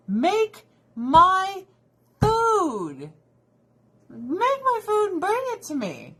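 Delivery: high, loud, whiny demand for food. Retold or imitated. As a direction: whine it out like a starving, impatient diner. Impatient diner demanding food.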